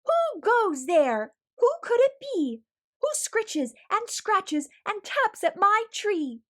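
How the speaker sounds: high, loud call to scare off an intruder; hollering. Acted. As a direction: yell it out in his biggest voice, trying to sound brave. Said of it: "hollered in his biggest voice yet".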